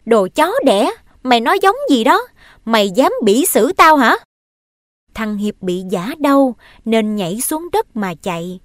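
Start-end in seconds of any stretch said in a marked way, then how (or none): 0.1-4.1 put-on loud, high cursing at the boy; angry scolding. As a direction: scold him hard and loud. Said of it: "mắng rằng".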